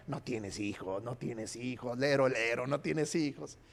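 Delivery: low, hushed playground teasing; needling sing-song mockery, kept quiet; hurtful taunt. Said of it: "qué le estaba lastimando Penina".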